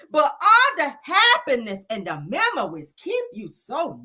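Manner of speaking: slow, lingering recital; mock-solemn spoken word; dramatic poem reading. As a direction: linger on each word like a eulogy.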